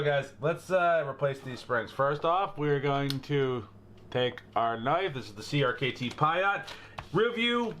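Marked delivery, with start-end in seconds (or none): none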